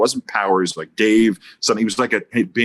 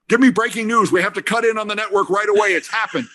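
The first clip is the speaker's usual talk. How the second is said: high-pitched breaking-news panic, acted; mock urgent; 11 semitones higher than usual. Reporters faking calls to the network, mock urgency. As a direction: play a frantic reporter phoning the desk.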